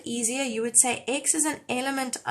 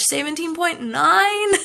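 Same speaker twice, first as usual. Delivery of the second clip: laughing; 6 semitones higher, 7 dB louder, slower than usual. Real, not acted. Laughing at rising like count.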